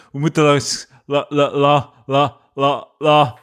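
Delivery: stuck stammering on "la"; mock stutter, repeating one syllable over and over; acted stutter. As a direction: stammer and never reach the word. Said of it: "dat je samen een doos aan het dragen bent".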